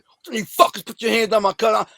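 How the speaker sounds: high, loud angry challenge; forceful confrontation. Retold or imitated. Storming up to the ballplayers over his cousin, forceful and angry. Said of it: "I said it with a lot more force".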